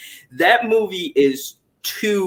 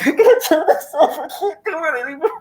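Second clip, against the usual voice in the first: much higher, laughing helplessly; laughing through tears; 12 semitones higher than usual. Retold or imitated. Retelling crying at the film, laughing through tears. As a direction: crack up while crying. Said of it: "I'm like".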